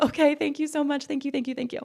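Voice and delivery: high, small-voiced imitation of grateful thanks; gushing thanks. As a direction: gush repeated thanks in a high voice. Acts out thanking admissions caller.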